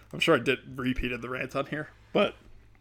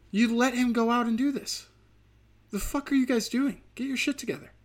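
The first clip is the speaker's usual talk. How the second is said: mock scolding; 10 semitones higher than usual. Scolding the cyclist's family.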